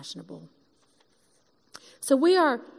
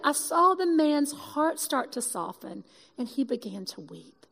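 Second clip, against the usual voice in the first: ordinary talk, nothing marked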